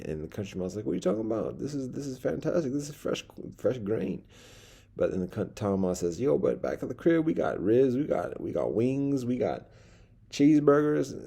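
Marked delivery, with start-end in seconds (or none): 0.9-4.2 high-pitched, incredulous protest; indignant country mouse. Acted. Country mouse answers his cousin's complaint, indignant and high. 6.2-11.1 lightly acted brag, pitched higher; boasting town mouse. Town mouse tempting his cousin with food, lightly acted boast.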